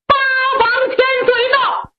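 high, fast announcing cry; herald's call. Acted. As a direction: call out the prince's arrival.

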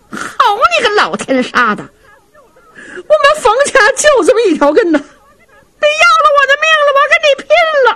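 very high, screeching wife's tirade; shrieking fury. Acted. Wife Feng raging at her husband over her nephew, shrieking fury. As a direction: scream it like a woman ready to fight to the death.

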